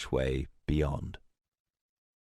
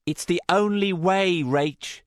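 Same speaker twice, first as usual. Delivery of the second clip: higher-pitched character voice; raised, resolute reply; insistent answer; 12 semitones higher, 4 dB louder than usual. A narrator's reading. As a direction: answer back in a lifted voice. Answers a desperate plea.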